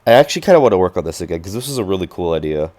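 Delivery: normal talking voice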